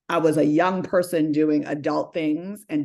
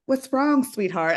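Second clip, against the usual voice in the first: a hint of a sweet teller, higher; lightly voiced concerned teller; kind bank teller; 8 semitones higher, slower than usual. Teller to the crying young model, gently concerned. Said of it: "And the woman's like".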